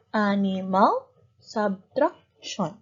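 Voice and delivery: "Animal" and "subtraction" measured out in three beats each; syllable by syllable. Shown as a demonstration. Teacher recaps three syllable words, "Animal, subtraction" beat by beat. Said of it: "Three syllable".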